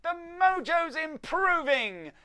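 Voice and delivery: high, loud, slow elf shout. Acted. Chief elf shouting over the dance, high loud elf cry. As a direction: shout the good news over the dancing. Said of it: "the chief elf shouted".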